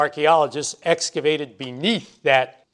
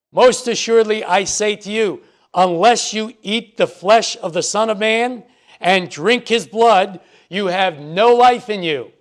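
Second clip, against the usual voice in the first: louder, higher, drink his blood slowed; emphatic scripture reading; 4 semitones higher, 4 dB louder than usual. Shown as a demonstration. Preacher stressing the Lord's warning, louder and higher. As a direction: press the warning home to the listeners. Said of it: "It means that what follows is very important".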